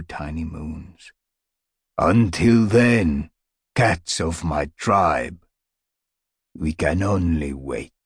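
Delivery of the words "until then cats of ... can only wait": solemn elder cat. Acted. Stone Teller's solemn close.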